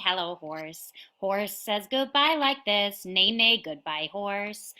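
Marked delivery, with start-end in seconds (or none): none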